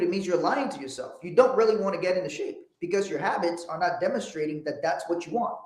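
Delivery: usual speaking voice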